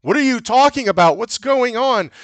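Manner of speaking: high-pitched mock bewilderment; high, hammed-up demand; retold baffled protest. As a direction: ask it like an overacted, confused film character. Retold confused reply in quarrel.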